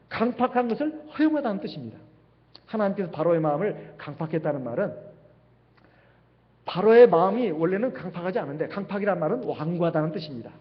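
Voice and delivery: ordinary delivery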